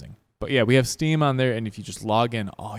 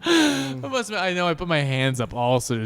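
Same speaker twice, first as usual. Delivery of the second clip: usual speaking voice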